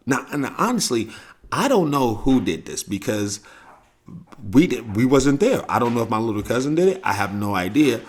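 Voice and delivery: ordinary talk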